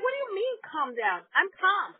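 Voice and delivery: high-pitched defensive retort; acting out an exasperated comeback; indignant protest. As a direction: insist on being calm while clearly riled. Protesting being told to calm down.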